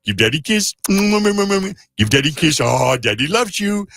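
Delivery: high, slow cooing pet talk; baby talk to pet. Shown as a demonstration. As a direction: coo up at the dog. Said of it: "That's how I talk to Josie".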